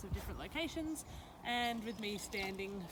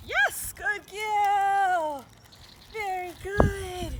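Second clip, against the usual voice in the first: praise; 9 semitones higher, 10 dB louder, much slower than usual. Real, not acted. Praising dog after standing try.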